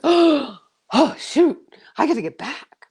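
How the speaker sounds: high, startled realisation; sudden alarm. Retold or imitated. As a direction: gasp as it dawns on you.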